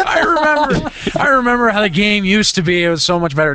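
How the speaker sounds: hammed-up nostalgic old man; old geezer. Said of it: "is this geezer radio here?".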